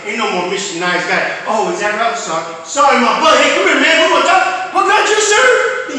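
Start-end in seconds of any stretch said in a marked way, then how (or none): 1.5-5.9 higher, louder challenge that builds; climbing hammed-up threat to the devil; mock tough confrontation. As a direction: square up and call him out. Acted fight with a taunter.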